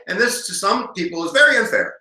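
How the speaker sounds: ordinary delivery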